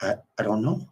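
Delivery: soft, faltering I don't know; timid reply. Retold or imitated. Kathy answering her date, lost and scared, soft. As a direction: trail off, lost and unsure. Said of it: "She's like".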